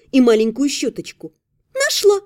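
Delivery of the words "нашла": high, joyful elf cry. A narrator's reading. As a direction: announce it gleefully, high. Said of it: "радостно провозгласила эльфийка".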